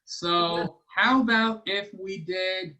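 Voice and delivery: ordinary talk, nothing marked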